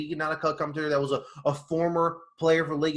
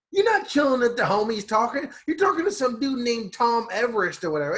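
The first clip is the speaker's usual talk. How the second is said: mock scolding; 7 semitones higher, 3 dB louder than usual. Acted scolding of the commentator.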